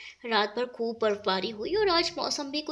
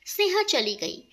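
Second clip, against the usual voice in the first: ordinary delivery, nothing marked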